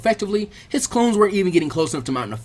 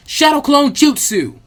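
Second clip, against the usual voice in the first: high, loud, drawn-out jutsu cry; battle cry; 9 semitones higher, 7 dB louder, slower than usual. Acted. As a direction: call the technique out with full force, slowly. Ninja casting technique mid-battle.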